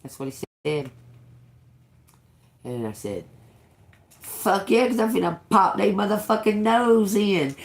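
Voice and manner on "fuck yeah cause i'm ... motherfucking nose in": fired-up retort. Retold or imitated. Retelling own fired-up reply to God.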